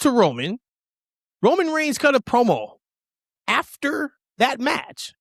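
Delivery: fired-up pitch climbing; heated rant. Real, not acted. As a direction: build up outrage at the booking.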